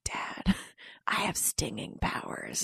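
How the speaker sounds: put-on hushed little boy, awed and slow. Son telling his dad about static, hushed child voice. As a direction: whisper it like a small kid sharing a secret. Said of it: "he looked at his dad and he was like".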